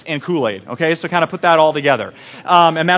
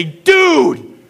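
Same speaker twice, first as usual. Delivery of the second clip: mock shout; 9 semitones higher than usual. Counselor confronting the kid.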